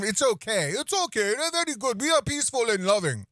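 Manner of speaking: put-on high sarcastic Middle Eastern accent; mock Middle Eastern accent. Mocking the clip's accented driver.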